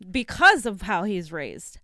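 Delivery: ordinary delivery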